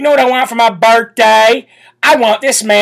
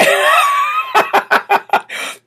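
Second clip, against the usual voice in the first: high laugh. Real, not acted. Laugh after mimicry.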